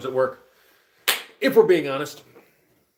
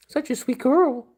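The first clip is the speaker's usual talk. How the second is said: the same words much higher, pet-talk style; 14 semitones higher, 6 dB louder than usual. Real, not acted. Owner gushing at the dog in high baby talk. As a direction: gush over the dog up high.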